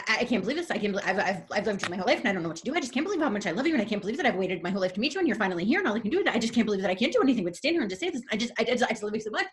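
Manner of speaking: re-enacted hysterical fan rambling; retold hysterical gushing. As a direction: gush helplessly without stopping, words tumbling. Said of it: "I'm still hysterical".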